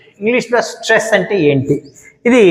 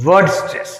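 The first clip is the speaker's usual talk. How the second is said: ordinary talk, nothing marked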